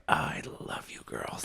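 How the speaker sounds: breathy whispered aside; hushed whisper of thanks. Retold or imitated. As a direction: whisper it like a secret to the girls.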